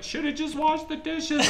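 smug mock mom; imitating mom scolding her hurt son; mom's voice. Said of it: "my mom was like".

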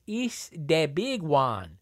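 mock Mexican accent, slow and drawn out. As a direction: ham up a roadside Mexican mascot accent. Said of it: "Pedro says".

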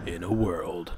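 whispered low trailer opener; movie trailer voice. Pretend. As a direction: breathe it like a film trailer tagline. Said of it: "was weak".

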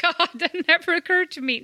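high, astonished and laughing; laughing realisation. Real, not acted. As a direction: laugh as the penny drops. Finally gets the pun and laughs.

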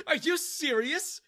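high, laughing, scornful disbelief; laughing mockery. Daniel mocks the girl's claim, laughing. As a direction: laugh through the ridicule. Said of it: "Daniel started laughing".

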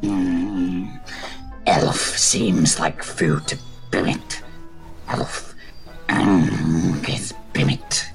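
low, slow dragon speaking in third person; half dragon voice. Acted. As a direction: rumble it slow like a beast. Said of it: "Bimit's just like".

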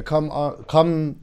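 normal talking voice

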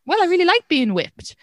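high mock voice of a man who enjoys whipping; mock kinky guy. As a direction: lampoon him, pitched well up. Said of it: "if you're like".

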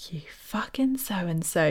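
hushed, slow whispered insult; whispered curse. Retold or imitated. Giver whispering an insult at ungrateful friend. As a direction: breathe the curse under your breath.

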